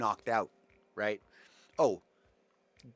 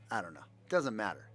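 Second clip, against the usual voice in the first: ordinary talk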